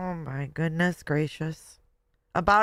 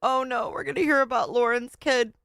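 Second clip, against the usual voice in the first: dismayed dread; 6 semitones higher than usual. Real, not acted. Dreads the next clip story.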